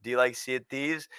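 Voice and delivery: ordinary delivery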